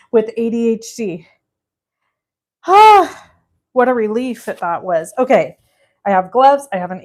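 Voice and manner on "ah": loud relieved sigh. Real, not acted.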